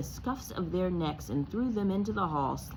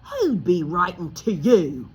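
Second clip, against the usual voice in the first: loud, slow sneer in a different voice; 9 dB louder than usual. Acted. Uncle Vernon to Harry, a loud slow sneer. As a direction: sneer it out, mocking the boy. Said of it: "sneered Uncle Vernon".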